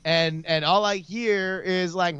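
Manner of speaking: ordinary delivery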